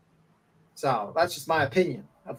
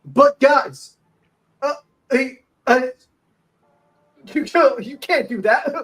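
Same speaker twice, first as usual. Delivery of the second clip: mocking imitation; 8 semitones higher, 7 dB louder than usual. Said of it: "he's like".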